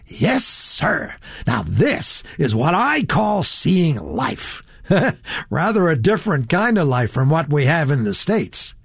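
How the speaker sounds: put-on swooping, relishing young American; naive enthusiasm. A narrator's reading. Franklin telling Satterthwaite of seeing life, gleefully enthusiastic. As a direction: draw out the key words with delight. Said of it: "who was full of enthusiasm".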